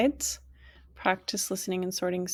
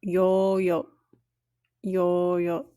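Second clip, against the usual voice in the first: yo called out a little louder, long o held; teacher calling yo; model word yo; 4 dB louder, much slower than usual. Shown as a demonstration. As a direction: call yo out like a yell. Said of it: "to yell".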